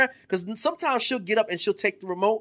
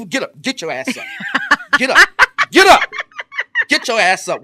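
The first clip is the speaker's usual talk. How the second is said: louder barking get up; mock wake-up yell; 8 semitones higher, 10 dB louder than usual. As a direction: yell her awake, rising loud. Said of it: "I shake her".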